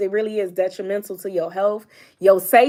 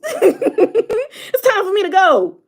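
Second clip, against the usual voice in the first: laughing, higher and louder, slowed right down; 12 semitones higher, 7 dB louder, much slower than usual. Real, not acted. Joking to followers about leaving, laughing through it. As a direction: laugh the line out slowly. Laughing at her own confused face.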